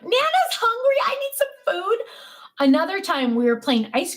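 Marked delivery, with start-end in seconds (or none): 0.0-2.0 high, whiny hungry-nana voice; grandma begging her grandson for pretend food; mock pleading. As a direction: beg like a pitiful hungry kid.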